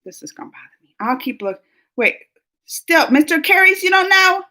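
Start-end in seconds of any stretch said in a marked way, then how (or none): 0.0-0.9 whisper. Real, not acted. Frustrated, can't recall.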